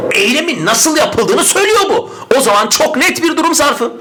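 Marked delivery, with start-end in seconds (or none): none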